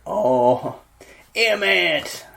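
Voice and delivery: lightly voiced chavvy lad; a hint of a cocky chav. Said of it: "like a chavvy".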